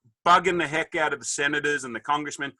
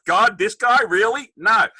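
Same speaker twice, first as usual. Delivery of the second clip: exasperated; 9 semitones higher, 8 dB louder, slower than usual. Retold or imitated. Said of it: "you know, like".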